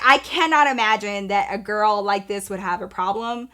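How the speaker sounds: normal talking voice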